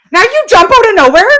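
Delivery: high loud fast indignant yell; outraged. Retold or imitated. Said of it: "And then I said".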